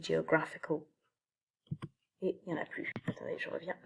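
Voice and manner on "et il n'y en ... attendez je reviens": whispered aside. Real, not acted.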